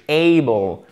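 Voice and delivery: ABLE with a wide, long "AY"; open diphthong. Shown as a demonstration. Teacher showing how to open the mouth, long diphthong. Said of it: "Faut vraiment ouvrir sa bouche".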